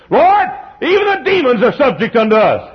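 high, loud triumphant cry; excited disciples. Acted. Disciples boasting to the Lord, high and triumphant. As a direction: crow it out in triumph.

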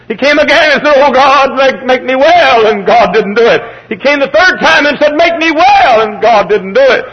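high, loud storytelling from the pulpit; impassioned, high sermon shout; full-force preaching. Real, not acted.

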